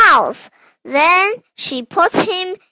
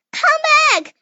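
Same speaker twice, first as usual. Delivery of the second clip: calling out; 11 semitones higher than usual. Acted. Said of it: "cried the little old man".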